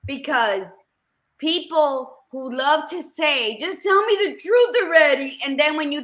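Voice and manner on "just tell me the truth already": mock demand. Mocking people who demand honesty.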